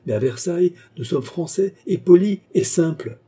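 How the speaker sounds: spring back hard with high, loud rebuke; wounded indignation. A narrator's reading. Queen denying she was at the ball, wounded indignation. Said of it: "avec la rapidité d'une panthère blessée".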